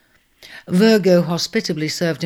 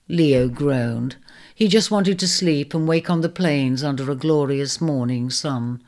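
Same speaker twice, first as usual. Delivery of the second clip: plain reading voice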